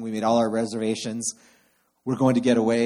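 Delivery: ordinary talk, nothing marked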